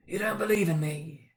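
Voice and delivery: a spectre testing him; ghost voice. A narrator's reading. Ghost questions Scrooge.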